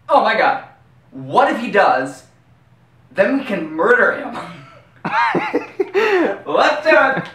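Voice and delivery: high, loud giddy excitement; manic glee. Acted. A quarterback plotting against Jeremy, gleeful and loud. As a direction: cackle with gleeful menace.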